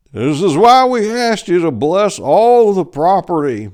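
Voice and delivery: put-on a worried old farmer pleading; old farmer Pickles. A narrator's reading. Mister Pickles voiced in story.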